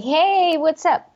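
high, bright-eyed cheery hello; happy greeting. Pretend. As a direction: greet them like you're in a great mood. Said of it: "If I'm happy".

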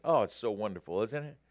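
ordinary delivery

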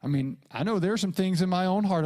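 ordinary delivery

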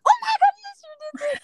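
very high-pitched excited outburst; re-enacted burst of excited discovery; excited squeal. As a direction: squeal it in delighted surprise. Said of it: "So I get all excited".

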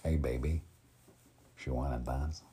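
lightly attempted Tony Montana Cuban accent; practised, understated Cuban-accented come-on. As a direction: have a go at a slight Cuban accent. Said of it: "still working on that part".

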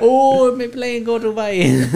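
high, loud mock Afghan accent, hammed up. Friends riffing on the runway story, hammed-up Afghan accent. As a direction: cry out for the plane in a put-on Afghan accent. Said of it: "You had to hit 'em with the accent".

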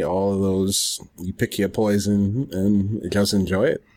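ordinary delivery

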